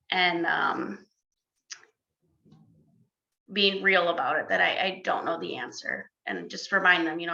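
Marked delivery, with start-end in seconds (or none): none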